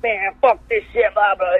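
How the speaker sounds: high, drawn-out mock rant; flipping out. Retelling a wrestler's outburst, mock flipping out. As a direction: imitate a wrestler losing it. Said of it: "Scotty starts flipping out".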